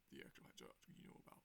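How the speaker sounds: breathy low whisper. Acted. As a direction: hush it to the others. Character line in retold scene.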